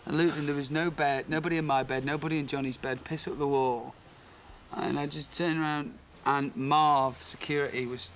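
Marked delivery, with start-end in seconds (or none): none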